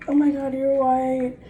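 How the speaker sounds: high, loud, slow fake gasp of surprise; mock shocked reaction. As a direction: mimic people acting stunned, drawn out. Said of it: "they're like".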